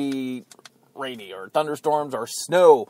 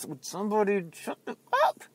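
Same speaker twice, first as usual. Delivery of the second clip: a snappy yapping-dog voice, higher and thin; 5 semitones higher, 5 dB quieter than usual. Retold or imitated. Mocking Cruz and Rubio as two yapping dogs. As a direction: yap it out like a little dog. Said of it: "two yapping dogs that are just like".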